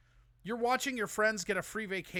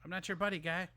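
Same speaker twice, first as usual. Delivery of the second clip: put-on Minnesota accent. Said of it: "Sometimes the accent just comes out".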